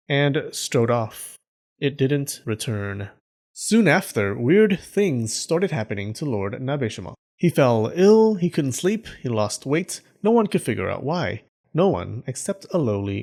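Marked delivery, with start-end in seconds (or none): none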